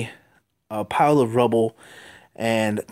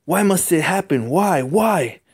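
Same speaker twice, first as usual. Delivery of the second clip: anguished cry; 7 semitones higher, slower than usual. Acted. Spider-Man laments over the dying captain, anguished. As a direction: cry out at the unfairness of the loss.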